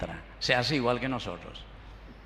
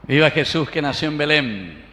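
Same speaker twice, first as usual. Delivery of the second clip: loud rallying cheer to the congregation; festive cheering; 2 semitones higher, 7 dB louder than usual. Real, not acted. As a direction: call it out to the whole church. Priest leads cheers, applause.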